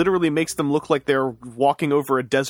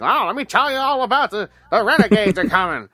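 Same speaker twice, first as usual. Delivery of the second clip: hammed-up Texan old-timer, pitched high; high old Texan codger voice; old man Texan; 7 semitones higher, 3 dB louder than usual. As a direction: put on an old man's Texan accent, laughing at it. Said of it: "he had an old man Texan voice".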